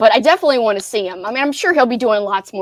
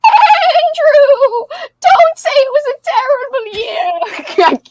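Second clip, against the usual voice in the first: high, bleating goat lady voice; 13 semitones higher, 4 dB louder, slower than usual. Pretend. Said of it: "I'm losing my goat voice".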